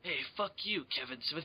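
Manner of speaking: low, soft action-star grumble; muttered insult. Acted. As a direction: grumble it under your breath. Muttered as Bruce Willis before yell.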